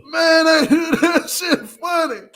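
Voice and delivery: high, loud laughing cry; can't stop laughing at how funny it is; laughing hard. Real, not acted. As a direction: crack up while saying it. Cracking up at clip.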